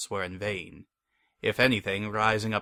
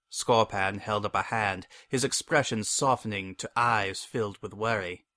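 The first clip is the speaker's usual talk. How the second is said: plain reading voice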